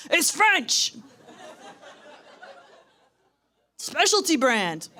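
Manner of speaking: loud, high mock bluster; blustering bluff. Dad covering for the ashes, loud hammed-up bluff. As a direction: bluff your way out loudly.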